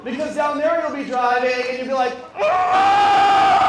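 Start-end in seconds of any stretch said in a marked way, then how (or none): 2.4-3.7 sudden piercing scream of road rage. Retold or imitated. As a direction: scream it out like a furious driver.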